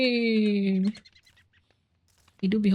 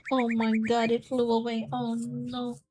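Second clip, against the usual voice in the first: ordinary talk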